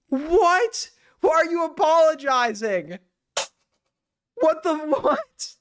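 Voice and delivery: high, disbelieving why are you apologizing; can't believe what he's hearing; incredulous. Real, not acted. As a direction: disbelief at a co-host who apologizes.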